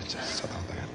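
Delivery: whispered softly, breath only; hushed whisper repeat. Shown as a demonstration. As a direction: say it under your breath.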